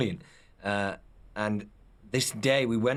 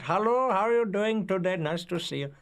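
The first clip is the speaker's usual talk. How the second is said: high, put-on Indian accent; 11 semitones higher than usual. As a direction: do a strong Indian accent impression. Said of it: "speaks with a very, uh, strong Indian accent".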